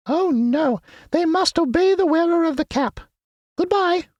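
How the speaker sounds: very high squeaky mouse queen; squeaky little voice. A narrator's reading. Queen of the Mice greeting her friends, squeaky little voice. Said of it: "in her squeaky little voice".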